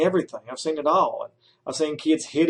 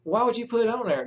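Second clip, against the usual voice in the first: incredulous question; 7 semitones higher, 4 dB quieter than usual. Acted. Supervisor questioning student teacher.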